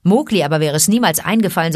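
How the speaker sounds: ordinary delivery, nothing marked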